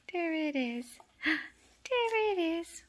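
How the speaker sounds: high, drawn-out excited coo; excited pet talk. Real, not acted. Showing kittens the toy, high excited pet talk.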